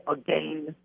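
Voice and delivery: "again" said the Canadian way; mimicked Canadian pronunciation. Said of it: "the way you guys say".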